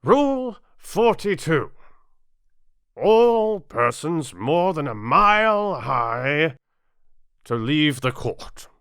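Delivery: slow, loud and high proclamation; king reading a rule from his book. Acted. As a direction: read the rule out grandly. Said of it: "read out from his book".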